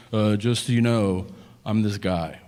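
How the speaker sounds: low-pitched put-on man introducing himself; dating guy's act. Guy introduces himself while dating, low put-on act. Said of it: "dating is a guy going".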